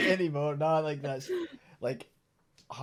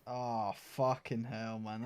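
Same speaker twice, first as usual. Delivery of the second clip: usual speaking voice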